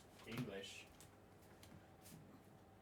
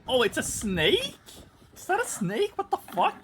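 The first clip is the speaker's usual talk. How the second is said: loud question leaping very high; shocked disbelief; 8 semitones higher, 7 dB louder than usual. Real, not acted. Streamer realising the animal is a snake, shocked and high-pitched. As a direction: squeal it in real shock.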